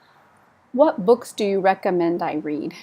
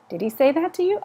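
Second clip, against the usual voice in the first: a lightly voiced friend asking, higher; role play question; 7 semitones higher, faster than usual. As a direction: ask it as another person in the dialogue. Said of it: "a friend asking, role play".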